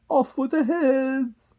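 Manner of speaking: high, loud, drawn-out order; screaming Queen. Acted. Queen condemning the gardeners, high and screaming. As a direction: stretch out the command at full voice.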